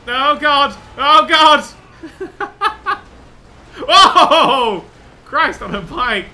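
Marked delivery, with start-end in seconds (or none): 0.1-1.6 loud, high cries of alarm; alarmed shout. Real, not acted. Crash comes at the player. 2.9-4.8 high laughter breaking into a loud whoop; real laughing outburst. Real, not acted. Laughs after the crash.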